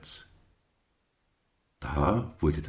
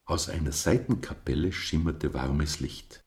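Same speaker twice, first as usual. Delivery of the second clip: ordinary delivery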